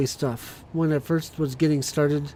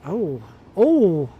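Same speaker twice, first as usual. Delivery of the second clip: surprised; 2 semitones higher, 8 dB louder than usual. Real, not acted. Sudden realization mid-sentence.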